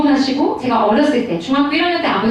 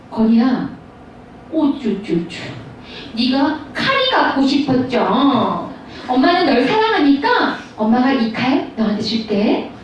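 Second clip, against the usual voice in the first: put-on slow cooing baby talk; doting mother; 3 semitones lower, slower than usual. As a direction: coo indulgently to a little son, drawn out. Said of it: "이렇게 얘기하는 게 사랑일까?".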